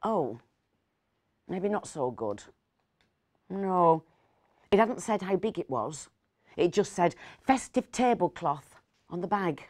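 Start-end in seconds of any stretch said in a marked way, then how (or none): none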